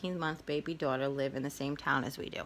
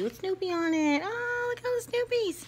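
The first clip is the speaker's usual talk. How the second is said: excited squeal; 3 dB louder than usual. Real, not acted. Delighted by Snoopy stationery.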